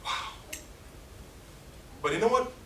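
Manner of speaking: ordinary talk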